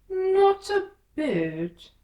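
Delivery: very slow, loud, high drawl; slow, high caterpillar drawl. A narrator's reading. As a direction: dismiss her lazily but loudly. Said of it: "said the caterpillar".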